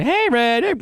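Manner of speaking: high voice for a pet bird; high bird talk. Shown as a demonstration. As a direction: call up sweetly to a bird.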